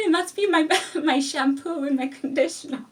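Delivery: high, loud, giggling excuse; retelling the lie while cracking up; laughing excuse. Retold or imitated. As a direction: laugh through the fib.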